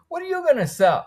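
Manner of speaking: high, doubtful imitation of his wife; mocking his wife. Man retelling his wife's doubts about his plan, in a high mocking voice. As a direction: lift the voice high to mimic a sceptical wife. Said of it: "she said".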